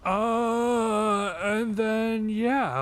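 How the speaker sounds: high and loud, eager to share the news; excited buildup. Real, not acted. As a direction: hype up the big reveal. Building up to announcing quitting.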